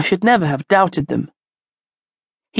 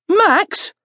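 high-pitched worried call; 13 semitones higher than usual. A narrator's reading. Frankie calls after his vanished dog, calling out. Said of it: "called Frankie".

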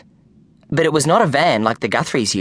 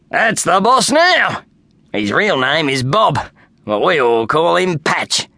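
confident Kenny; 2 semitones higher than usual. A narrator's reading. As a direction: insist brightly he has it right. Kenny voiced in story.

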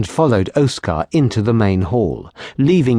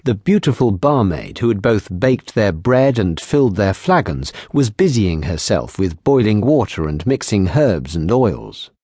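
ordinary delivery

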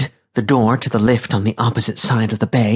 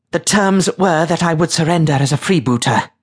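high, haughty upper-class tone; imperious lady stating her terms; lofty, clipped; 7 semitones higher than usual. A narrator's reading. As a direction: pitch it high and lofty, every word clipped. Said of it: "Katerina kept her voice lofty, her tone clipped".

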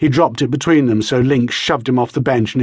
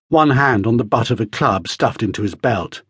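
plain reading voice

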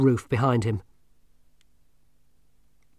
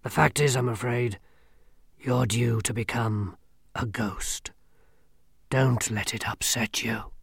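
grim reaper voice. Acted. Death speaks.

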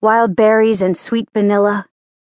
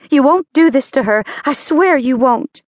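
angry; 6 semitones higher than usual. Acted. Angie defends mother, confronts Jeff.

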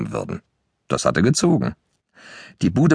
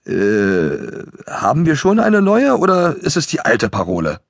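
hesitant question; 5 semitones higher than usual. A narrator's reading. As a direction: ask it like a confused, startled kid. Hansi startled, unsure of password. (verunsichert, zögernd und hoch gefragt)

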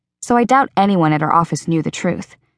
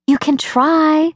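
higher, slow, lightly voiced eager girl; Rachel's voice; 9 semitones higher, slower than usual. Rachel talking her friend into homecoming, slow and higher. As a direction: nudge her gently toward the dance.

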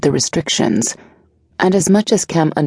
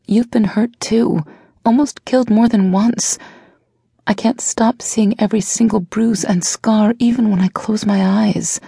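lightly voiced younger woman, coaxing; a gentle, probing lover; Blair's voice; 8 semitones higher than usual. A narrator's reading. Blair's voice, pained.

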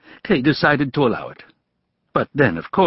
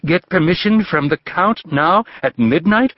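cried out; 7 semitones higher than usual. A narrator's reading. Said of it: "cried the young man".

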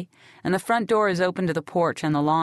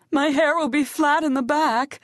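Brandy fretting; 10 semitones higher than usual. Acted. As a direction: whine it like vanity beats dying. Wounded Brandy frets about hair.

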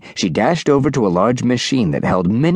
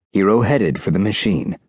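ordinary delivery, nothing marked

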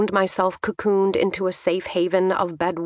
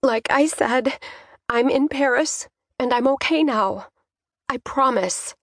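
higher daughter's voice, holding it steady; carefully controlled, high-pitched reassurance; steadied voice; 6 semitones higher than usual. A narrator's reading. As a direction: reassure dad while keeping tears back. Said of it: "I swallowed hard to steady my voice".

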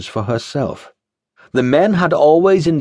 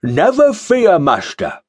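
bombastic bellow; 6 semitones higher than usual. Acted. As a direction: bellow it grandly. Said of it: "bellowed a bombastic acolyte".

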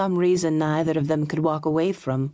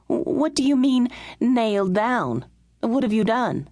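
exclaiming; 7 semitones higher than usual. A narrator's reading. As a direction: burst out, slowing on nailed down. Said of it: "she exclaimed".

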